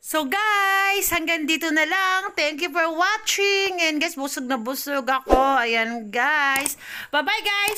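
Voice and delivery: loud, slow closing words to the viewers; vlog sign-off. Acted. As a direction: wrap up the video, drawn out and loud. (malakas at mabagal na pamamaalam sa mga manonood)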